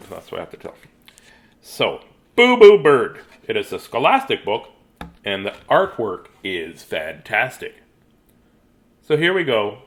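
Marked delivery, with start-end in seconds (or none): none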